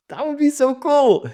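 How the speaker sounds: light up at the idea; real excitement. Real, not acted. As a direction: writer thrilled by her own plot idea. Speaker thrilled by her new idea.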